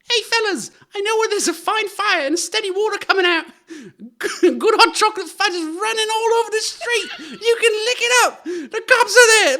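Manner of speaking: put-on very high, loud squeaky kid voice, laughing through it; little scamp. Urchin at the fire urging friends along, high squeal. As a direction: squeal it like a giddy street urchin. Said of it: "can I hear it as a scamp".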